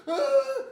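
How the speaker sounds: very high, loud wail; child's cry. Retold or imitated. A child just hit, wailing loudly. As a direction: shriek like a hurt little kid. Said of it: "get hit and they go".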